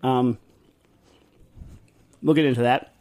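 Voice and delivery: chewing while talking; mouth full. Real, not acted. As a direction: say it through a mouthful. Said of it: "Excuse me, I'm eating".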